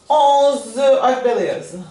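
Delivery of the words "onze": onze said the French way, ending on the Z. Shown as a demonstration. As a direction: say onze with no final vowel. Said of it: "falando tudo igual que em português". (onze em francês, terminando no Z)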